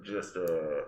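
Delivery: low, slow airline captain; a touch of cockpit intercom; pilot voice. Acted. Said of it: "oh no, I sound like a pilot".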